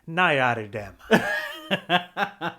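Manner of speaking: slow, laughing mock outrage; laughing disbelief. Real, not acted. Friend teasing the other for never seeing Pirates, laughing.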